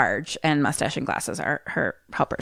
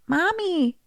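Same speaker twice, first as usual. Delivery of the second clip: put-on little girl; 10 semitones higher, slower than usual. Little girl's voice from TV.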